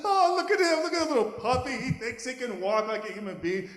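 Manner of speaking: high, loud mocking through laughter; laughing teenagers. Teenage dogs jeering at the puppy trying to walk, laughing. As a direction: jeer while cracking up. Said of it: "they're laughing".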